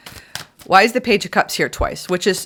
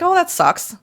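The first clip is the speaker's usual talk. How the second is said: mock disappointment; 10 semitones higher than usual. Voicing disappointed partner.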